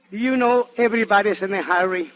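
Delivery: ordinary talk, nothing marked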